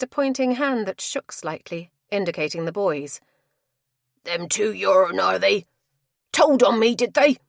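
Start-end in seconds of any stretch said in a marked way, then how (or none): 4.2-7.4 angry. Acted. Tapster accused by the investigator.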